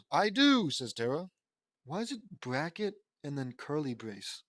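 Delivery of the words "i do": higher, louder burst of mock amazement; amazed. Tara discovering she has wings, amazed. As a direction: blurt it out in wonder.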